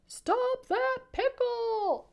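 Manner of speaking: very high, slow, loud cartoon cry; shouting sandwich. A narrator's reading. Sandwich chugs after the pickle, high stretched shout. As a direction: shout it as a peanut butter and jelly sandwich. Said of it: "it shouted".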